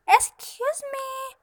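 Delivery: high, loud, drawn-out indignation; in a huff. A narrator's reading. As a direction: stretch it out, highly affronted. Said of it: "said the fairy godmother in a huff".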